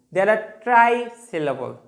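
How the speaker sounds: ordinary delivery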